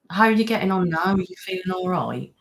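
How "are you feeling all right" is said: ordinary delivery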